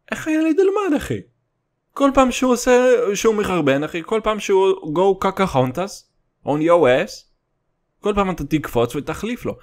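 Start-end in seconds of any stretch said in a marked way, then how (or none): 0.1-7.1 mock outraged. Mock rant about diaper changing.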